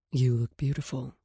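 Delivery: man's voice. Acted. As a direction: speak as a calm man. Voices Knox lower.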